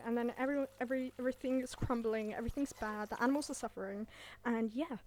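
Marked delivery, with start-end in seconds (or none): none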